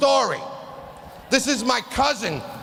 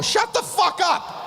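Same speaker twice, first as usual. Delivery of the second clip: angry retort; 8 semitones higher, slower than usual. Pretend. Telling off a person with a grim cancer story, angry. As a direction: cut them off in disgust. Snapping at oversharer.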